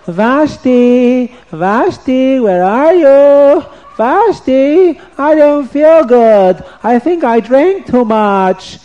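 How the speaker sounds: high, loud, slow calling for his queen; king calling out. Acted. Hungover king calls out for his wife, high, loud and slow. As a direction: call out for Vashti, feeling sick.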